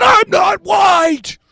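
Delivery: high, drawn-out shouted denial; looking-to-kill anger. Acted. Man surrounded by fifteen people, furious shouted denial. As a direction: yell it slowly, word by word, outraged. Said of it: "I'm looking like I'm gonna kill".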